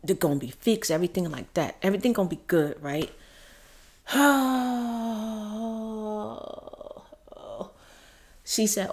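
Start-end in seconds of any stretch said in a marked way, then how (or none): 4.1-6.9 long, drawn-out voiced sigh; heavy sustained sigh. Real, not acted. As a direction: let out one big exhale slowly. Long sigh mid-story before going on.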